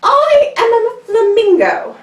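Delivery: high, loud, slow haughty declaration; high loud slow proud flamingo. Acted. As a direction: announce it grandly like a snooty bird. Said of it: "and says".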